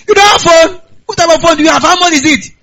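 high, loud disbelief; can't believe he has no phone; shocked uncle. Pretend. Uncle shocked nephew lacks phone.

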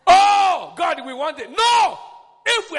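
mock pleading then shout. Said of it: "the church kept quiet".

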